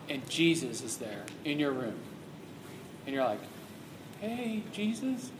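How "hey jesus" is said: soft, slow, timid greeting; a hint of fright in the dark; mock terrified. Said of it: "'cause you're terrified".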